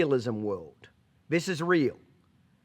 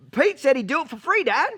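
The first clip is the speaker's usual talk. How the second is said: excited son; 10 semitones higher, 6 dB louder than usual. Retold or imitated. Son reports to dad in joke.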